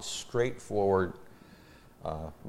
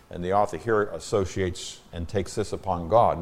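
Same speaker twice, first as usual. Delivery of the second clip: ordinary delivery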